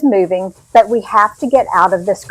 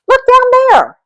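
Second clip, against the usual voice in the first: high, loud excited shout down the platform; excited call; 5 dB louder than usual. Acted. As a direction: call out to the family, thrilled. Kid spots empty train car.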